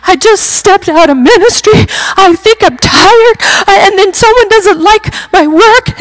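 high, teary retold blubber; mock a tearful ramble through sobs; a touch of imitated crying. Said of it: "I'm apparently blubbering".

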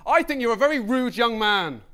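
indignant shout. Retold or imitated. Stranger shouting after him at the exit, indignant yell. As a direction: scold a rude young man at full volume. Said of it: "he shouted".

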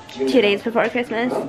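talking while chewing ice; words round crunching ice; ice in mouth. Real, not acted. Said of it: "sorry, I'm chewing ice".

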